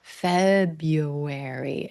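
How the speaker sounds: February very slow, first syllable stressed. Shown as a demonstration. Teacher explains which syllable both speakers stress, then stresses it. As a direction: lean hard on FEB in February. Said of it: "we both stress that first syllable".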